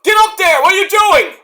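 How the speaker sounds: high, loud exasperated yell; commentator yelling. Retold or imitated. Commentator yelling at the wrestler, high and loud. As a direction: shout it like a fed-up commentator. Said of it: "commentator's like".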